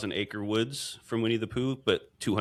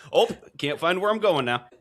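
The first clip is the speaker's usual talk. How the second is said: put-on Omaha accent; 9 semitones higher, 5 dB louder than usual. Continuing the Omaha accent bit.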